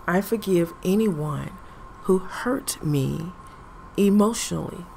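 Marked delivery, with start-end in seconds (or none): none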